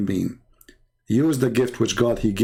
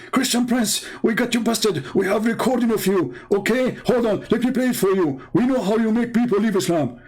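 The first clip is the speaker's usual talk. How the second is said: mocking excited caller; 9 semitones higher than usual. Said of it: "the name started growing".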